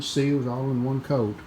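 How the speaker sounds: usual speaking voice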